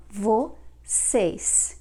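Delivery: "vocês" slowly, in two parts, with final s; "vocês" drawn out to a clear closing s; plural s ending. Shown as a demonstration. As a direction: say "vocês" carefully, sounding the s at the end. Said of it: "Now the plural form".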